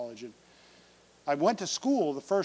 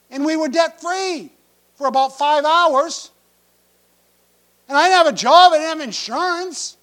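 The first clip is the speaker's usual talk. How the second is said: building from plain to high, loud alarm; retold panic; 7 semitones higher, 9 dB louder than usual. Broke Bible student facing the hospital bill, rising to loud panic. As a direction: pile up the worries in rising panic.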